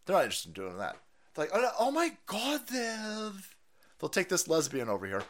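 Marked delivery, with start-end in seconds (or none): 1.5-3.5 a hint of soft lisping caricature; mocking lisp. Host mimics the official, lightly mocking lisp. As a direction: lightly put on an exaggerated lisp.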